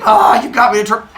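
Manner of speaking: loud, high, quick complaint; hammed-up outburst at the culprit; mock upset. Father Sky complains after throwing up.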